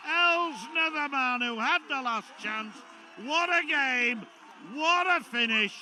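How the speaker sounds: high, loud commentary building to a shout; excited play-by-play. Real, not acted. Commentator calling a final's last chance, excited play-by-play. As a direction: call the dying seconds at full pitch. Live play-by-play in closing seconds.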